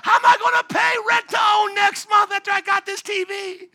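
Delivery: very high, loud mock wail; hammed-up broke man crying to Jesus; panicked broke person. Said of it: "That's what you sound like".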